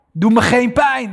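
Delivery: a scared voice begging for mercy; frightened plea. Acted. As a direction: beg not to be hurt.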